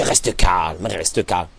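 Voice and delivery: low, slow and soft, coaxing calm; soothing tempter. Acted. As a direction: whisper calm into his ear like the evil inclination. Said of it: "le yetzerara".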